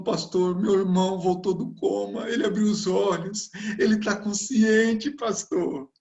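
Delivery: high-pitched, excited tearful-sister imitation; imitated joyful cry. Sister tells pastor her brother woke up, imitated joyful cry.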